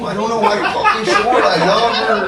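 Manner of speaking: lightly put-on street accent; changed accent. Asking the homeboys for love advice in a light street accent. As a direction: slip into the homeboys' accent a bit. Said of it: "my accent would change".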